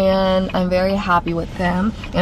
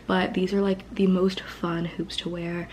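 whisper; 7 dB quieter than usual. Real, not acted. Vlogger whispering about her hoops to viewers. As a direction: whisper it like a little secret.

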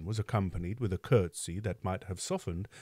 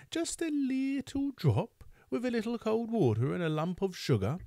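coaxing Mrs Mann; 13 semitones higher than usual. Acted. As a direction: tempt him with a little drop. Mrs Mann tempting the beadle.

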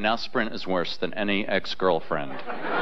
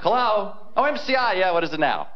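annoyed answering; 9 semitones higher than usual. Retold or imitated. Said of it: "I'm like".

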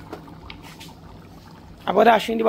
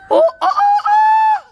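high squeal; 8 dB louder, much slower than usual. Real, not acted. Excited squeal at baby's move.

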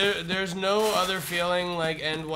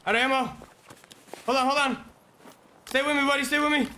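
urgent high shouting under fire; man down shout; 9 semitones higher, 3 dB louder, faster than usual. Real, not acted. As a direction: yell for your buddy to hang on. Firefight, teammate going down.

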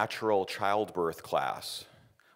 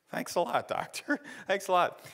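high, laughing sarcastic thanks; 10 semitones higher than usual. Retold or imitated. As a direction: thank him with a laughing sneer.

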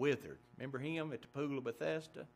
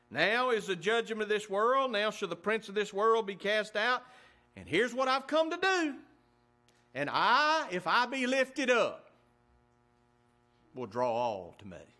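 preaching climax; 5 semitones higher, 5 dB louder than usual. Acted. Quoting Jesus at full force.

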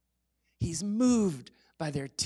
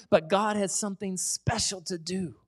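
usual speaking voice

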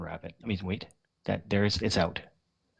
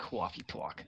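soft, badly faked New York accent; horrible New York accent; 15 dB quieter, slower than usual. Joking to friends while one steps away, poor New York accent. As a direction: do a bad New York accent under your breath. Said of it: "it's a horrible New York accent".

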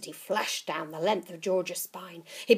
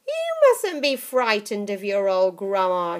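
put-on coaxing old witch; 3 semitones higher, 10 dB louder than usual. Grandma coaxing.

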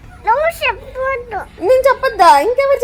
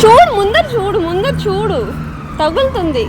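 calling out; 5 semitones higher, 9 dB louder than usual. Real, not acted. Warning a child about walls.

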